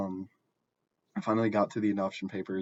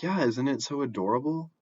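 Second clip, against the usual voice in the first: higher, louder gushing over the pet; mock-gushing sister, pitched up; 6 semitones higher, 3 dB louder than usual. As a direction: coo at the pet to win him over. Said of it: "she's like".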